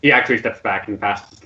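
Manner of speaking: usual speaking voice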